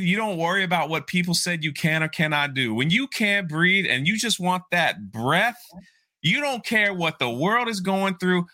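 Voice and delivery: high, driving motivational speech; Eric Thomas. Retold or imitated. Public speaker driving home the breathing story, impassioned. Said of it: "this guy is a public speaker".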